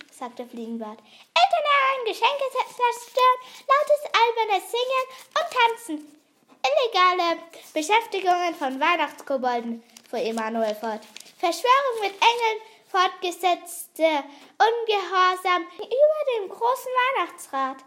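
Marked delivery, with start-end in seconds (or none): 1.0-9.8 high, loud, insistent list; goblin reciting charges. Acted. Fliegenbart lists Julebuck's alleged offences, high and indignant. 11.4-17.8 high, loud formal accusations; goblin reciting charges. Acted. Emanuel continues the list of court charges, high and proclaiming.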